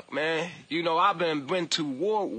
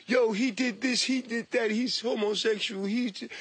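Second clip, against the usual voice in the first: put-on gossiping voice, pitched above his murmur; mocking detractors. Imitating online critics listing accusations, mocking gossip. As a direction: imitate people making fun of you. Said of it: "you could be like".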